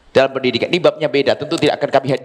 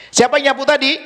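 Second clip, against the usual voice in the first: mock stern mother; 8 semitones higher than usual. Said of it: "Sang ibu langsung".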